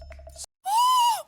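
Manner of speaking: sharp high gasp; shocked gasp. Real, not acted. Reacts to pancake prices.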